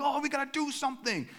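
high, loud squeak of alarm; playing his own alarm for laughs; mock panic.

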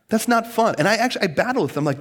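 ordinary delivery

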